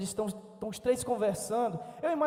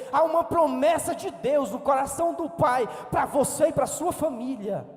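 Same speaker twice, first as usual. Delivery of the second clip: loud, driving sermon delivery with hammered phrases; preaching at full force; 5 dB louder than usual. Acted. Pastor urging the congregation to believe, loud and driving.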